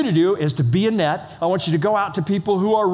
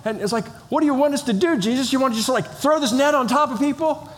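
higher, puzzled fishermen, laughing at the idea; baffled fishermen; 7 semitones higher, faster than usual. Retold or imitated. Fishermen puzzled by Jesus, mock-incredulous, half laughing. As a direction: play the confused disciples scoffing at the net. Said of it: "the disciples are there going".

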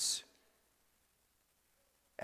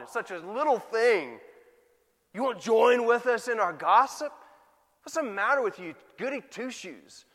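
higher-pitched scornful peer pressure; mocking; 5 semitones higher, 4 dB louder than usual. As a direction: jeer and coax like a scoffing friend. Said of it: "you are mocked because of it".

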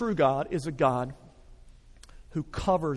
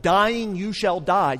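Dying and die pressed hard and louder; emphatic; 5 dB louder, slower than usual. Shown as a demonstration. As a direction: lean into "Dying, you shall die" with weight. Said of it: "that is emphasized".

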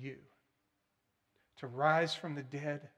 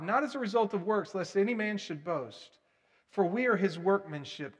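loud, raised recitation, slowing at the end; scripture recitation; 3 semitones higher, 7 dB louder than usual. Acted. Preacher reciting Ephesians to the congregation, proclaiming. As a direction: proclaim the verse.

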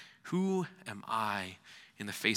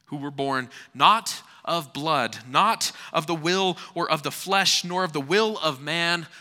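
rising, forceful proclamation; loud preaching; 6 semitones higher, 10 dB louder than usual. Acted. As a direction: build it up and declare. Building scripture reading to climax.

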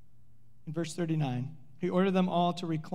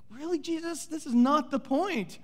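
exasperated disbelief; 7 semitones higher than usual. Acted. Disciples exasperated by Jesus' answer.

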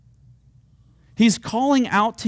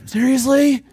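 higher and louder, exasperated complaint; imitating impatient believers nagging God; whiny demand; 9 semitones higher, 5 dB louder than usual. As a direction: demand it like a fed-up kid. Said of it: "that's what it sounds like when we talk to him".

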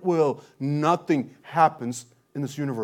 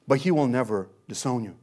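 normal talking voice

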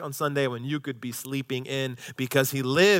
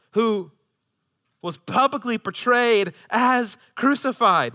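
high, loud, drawn-out proclamation; full-force preaching; 10 semitones higher, 7 dB louder, slower than usual. Acted. Preacher urging the church to look back at Christ, full-force preaching. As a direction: press each phrase home, high and loud.

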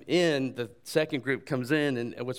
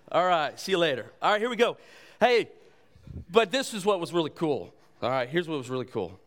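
ordinary delivery

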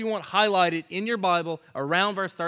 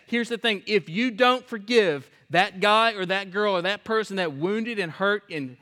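normal talking voice

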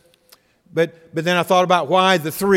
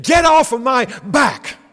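higher, louder exasperated shout; angry retort; 9 semitones higher, 6 dB louder than usual. Retold or imitated. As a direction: snap it back at the accusers. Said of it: "It's as if he said".

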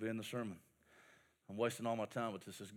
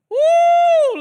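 excited whoop; 13 dB louder than usual. Real, not acted. Sea parting celebration.

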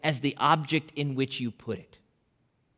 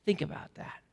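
whispered; 9 dB quieter than usual. Real, not acted. Preacher invites reflection, hushed.

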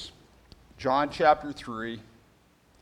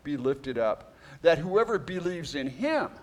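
scripture reading; 4 semitones higher, 3 dB louder than usual. Acted. Reads scripture aloud.

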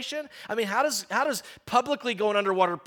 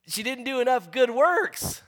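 higher mock jab at the punchline; mocking coworker; 6 semitones higher, 3 dB louder than usual. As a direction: needle him with the punchline. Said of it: "He goes, "Don't you get it?".

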